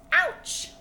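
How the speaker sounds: high, loud pained yelp; ouch. Acted. Reader reacting to cats sitting on gnats, high yelp. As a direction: yelp at a sting. Gnat bite exclamation in story.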